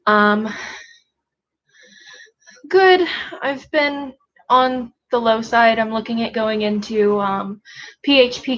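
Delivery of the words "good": high, loud praise to dog. Real, not acted.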